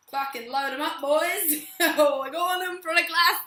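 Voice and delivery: loud, high Aussie bloke voice; Australian accent. Shown as a demonstration. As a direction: ham up a rowdy Aussie. I'm talking in an Australian accent.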